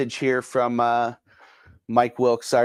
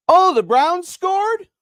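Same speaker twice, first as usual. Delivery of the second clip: surprised question, much higher and louder; wife calling from other room; 6 dB louder, slower than usual. Retold or imitated. Wife calling from the other room, surprised and high. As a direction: call out puzzled from down the hall. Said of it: "my wife's from the other room said".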